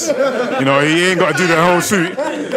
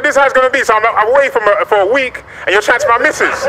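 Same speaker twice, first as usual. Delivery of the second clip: loud, high-pitched outrage; indignant telling-off; 7 semitones higher, 6 dB louder than usual. Retold or imitated. Telling off driver for chatting to his missus, indignant. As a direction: lay down the law, voice raised.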